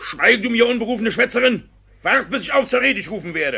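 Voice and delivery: loud, sharp scolding from the bench; angry rebuke. Acted. Judge scolds the interrupting woman, loud and angry. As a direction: silence her at full volume.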